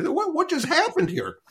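high, squeaky disbelief; cleaned-up retake, still high with shock; stunned disbelief. Retold or imitated. Asking the shop owner what just happened, high with disbelief.

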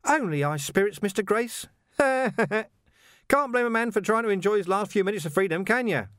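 high, chirpy villain with a laugh; mock innocence, grinning high; cheerful menace, high-pitched; Carcer's voice. A narrator's reading.

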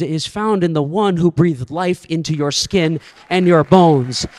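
usual speaking voice

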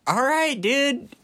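high, breezy dismissal; casual shrug. Retold or imitated. Kid brushing off parents' warning, high breezy shrug. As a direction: toss it off like a clueless kid.

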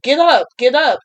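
loud mock coach shout; coach yelling. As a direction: bark it like a coach yelling from the sideline. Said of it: "the coach is yelling at me too".

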